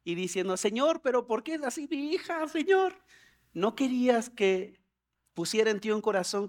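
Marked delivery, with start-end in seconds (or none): 0.6-2.9 imitated whine climbing high at the end; mock tearful complaint to God; whining, crying. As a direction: plead like a worn-out parent. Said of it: "ayer estaba chillando".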